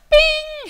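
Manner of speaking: high, ringing "bing"; loud, high electronic ping imitation; imitated GPS ping. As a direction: chime it out like a GPS alert. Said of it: "before the ping".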